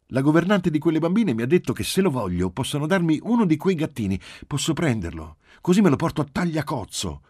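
put-on high, loud child voice; small girl bursting with her news; eager little girl. A narrator's reading. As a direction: plead excitedly for a kitten.

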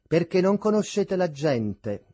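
bitter interjection. A narrator's reading. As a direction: cut in with some bitterness. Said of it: "interloquì con grande amarezza".

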